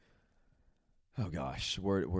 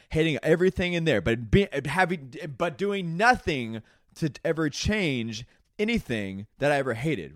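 rising rant; 6 semitones higher, 5 dB louder than usual. Real, not acted. Rant building against regret.

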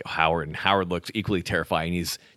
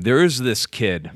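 normal talking voice

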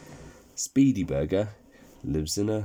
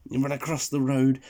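ordinary delivery

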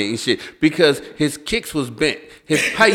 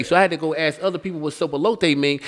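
ordinary talk

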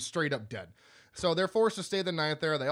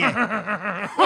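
loud, high imitated creepy cackle; creepy laugh; 3 semitones higher, 7 dB louder than usual. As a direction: mimic a creep's long, pulsing laugh. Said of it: "the creepiest laugh".

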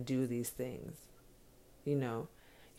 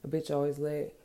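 normal talking voice

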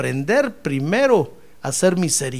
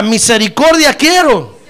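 louder, slow call-and-response; 3 semitones higher, 8 dB louder, slower than usual. Shown as a demonstration. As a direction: proclaim it loudly and slowly. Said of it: "A ver, diga". (proclamación fuerte y lenta)

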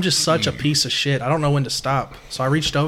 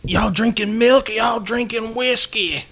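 slow, lightly higher mock coach drawl; coach impression; 6 semitones higher, slower than usual. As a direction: lightly voice the old coach's jab. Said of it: "what did he say".

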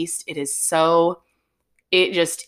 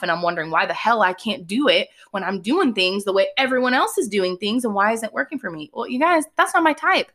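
ordinary talk, nothing marked

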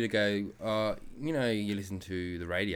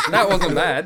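loud, high laughing words; 9 semitones higher, 11 dB louder, slower than usual. Real, not acted. Dad pleased after his accent attempt, laughing.

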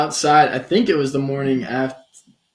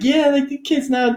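high-pitched imitated grandparent; grandparents' voice; 12 semitones higher than usual. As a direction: play an easygoing grandparent, pitched up.

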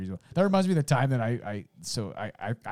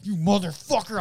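high, loud cursing outburst; retold fed-up anger; 8 semitones higher, 5 dB louder than usual. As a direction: act out finally snapping at a neighbour. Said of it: "I'd had it".

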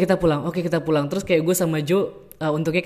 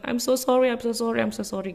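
higher, tearful mock sobbing apology; imitating crying Jo; 7 semitones higher than usual. Jo apologising after missing the bus, tearful. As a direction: act out Jo weeping sorry, lightly. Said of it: "dia langsung nangis".

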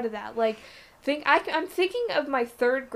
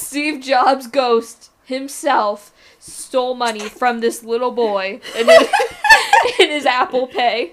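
mock outrage; 12 dB louder than usual. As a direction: proclaim an absurd excuse, loud and half laughing. Mocking Sky's absurd excuse.

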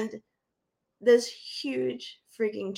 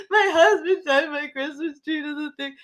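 teary; 6 semitones higher than usual. Retold or imitated. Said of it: "And I'm like".

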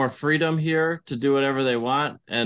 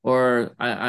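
normal talking voice

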